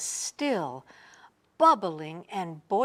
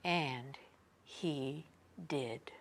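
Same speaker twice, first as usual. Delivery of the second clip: fading soft whisper; 7 semitones lower, 11 dB quieter than usual. A narrator's reading. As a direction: close the story gently. Soft story ending.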